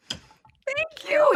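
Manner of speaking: very high-pitched, emotional thanks; high little squeal of gratitude; retold touched thanks. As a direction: imitate being moved, voice going way up. Retelling being comforted by her son.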